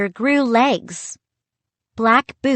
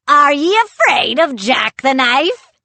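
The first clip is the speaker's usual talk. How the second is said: loud, raised pirate roar; big theatrical pirate challenge; booming pirate; 7 semitones higher, 6 dB louder than usual. A narrator's reading. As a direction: boom it out as a menacing giant pirate. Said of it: "he boomed".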